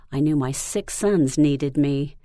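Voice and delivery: usual speaking voice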